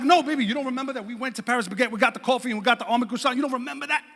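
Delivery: hurried, coaxing self-defence; pleading husband. Retold or imitated. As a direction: rattle off the reminders as if pleading. Husband pleading his case to wife.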